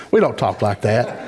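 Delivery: ordinary talk, nothing marked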